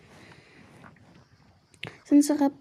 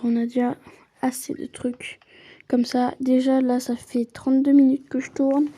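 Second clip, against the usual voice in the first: normal talking voice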